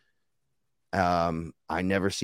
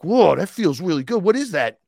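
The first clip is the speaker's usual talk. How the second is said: high, loud, wowed outburst; astonished and thrilled, pitched way up; amazed reaction; 8 semitones higher, 5 dB louder than usual. Retold or imitated. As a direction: blurt it out high in wonder. Retold amazement touching the robe.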